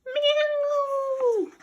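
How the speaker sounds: very high screeching meow; screaming cats. Acted. Cats terrified by water, screeching. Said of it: "screamed the cats".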